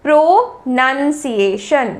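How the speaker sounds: प्रोनंसिएशन slowly, "pro-nun-see-ay-shun"; slow repeat-after-me प्रोनंसिएशन; slow model pronunciation. Shown as a demonstration. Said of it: "फिर से मेरे साथ कहिए".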